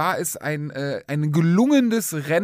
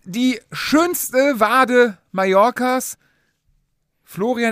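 slow grand announcer; 6 semitones higher, 5 dB louder, much slower than usual. Acted. Introducing co-host like an announcer.

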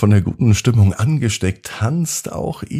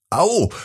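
sharp high yelp of pain; a sudden startled cry; startled ouch; 12 semitones higher than usual. A narrator's reading. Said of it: "erschreckt sich Yvonne".

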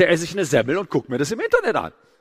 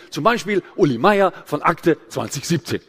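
pauses every few words, after "Beispiel", "Meier", "Akte"; Uli Meier. Retold or imitated. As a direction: imitate a presenter chopping up the line before "zwanzig siebzehn". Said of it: "Der macht alle paar Worte eine Pause". (Pausen nach "Beispiel", "Meier" und "Akte")

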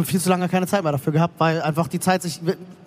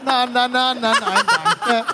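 playful singsong refusal; 3 semitones higher, much slower than usual. Pretend.

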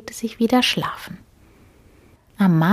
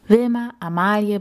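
plain reading voice

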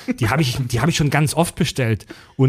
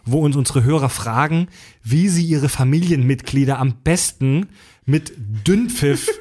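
normal talking voice

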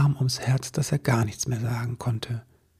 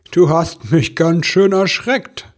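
loud, slow, higher Santa voice; big figure recovering from laughter; spluttering Santa; 5 semitones higher, 7 dB louder, slower than usual. Acted. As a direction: splutter it out after a laughing fit. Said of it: "prustete der Weihnachtsmann".

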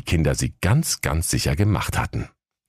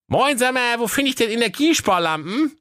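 put-on high cheeky boy voice; bratty teenage boy, much higher; cheeky young lad. Aladdin asks the worker.

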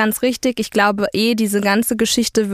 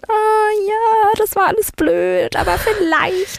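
giddy hopeful; 7 semitones higher than usual. Retold or imitated. Replying to his message, a hammed-up high dreamy voice. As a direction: gush like a smitten girl. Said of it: "bin ich dann auch so gewesen".

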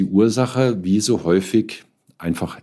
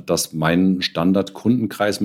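ordinary talk

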